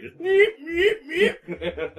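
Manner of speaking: high, slow laughing meep; squeaky marker sound. Pretend. Mimicking a Sharpie redacting the papers, squeaky.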